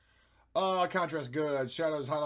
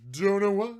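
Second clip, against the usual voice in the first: loud high repeat; higher, louder take; 6 semitones higher, 11 dB louder than usual. Pretend. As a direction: belt it up high.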